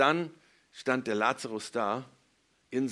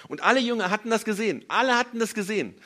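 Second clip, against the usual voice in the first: loud, high, insistent emphasis; nachdrücklich; 8 semitones higher, 7 dB louder than usual. Real, not acted. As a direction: hammer it home on a raised voice. Betont, dass alle Zeugen waren. (laut und eindringlich wiederholt)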